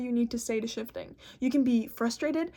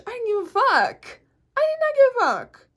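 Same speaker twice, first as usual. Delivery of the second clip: higher and louder, emphatic; overexcited; 11 semitones higher, 7 dB louder than usual. Real, not acted. Overexcited insistence about seeing the ocean. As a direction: hammer it home giddily. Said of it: "I got a little overstimulated there".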